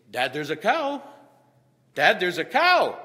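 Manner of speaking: put-on insistent child call. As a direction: keep calling until he answers. Son repeating until acknowledged.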